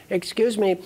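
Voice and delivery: high-pitched mock-polite request; hammed-up polite pleading; insistent questioner. Banker's question, acted out.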